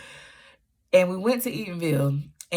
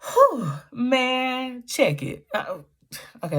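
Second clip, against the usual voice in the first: choked up; 9 semitones higher, slower than usual. Real, not acted. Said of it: "let me calm down".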